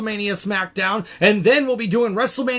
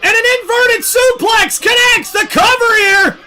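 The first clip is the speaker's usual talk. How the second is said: live play-by-play, rising to shouts; wrestling commentator; 7 semitones higher, 6 dB louder than usual. Acted. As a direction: call the match like ringside commentary.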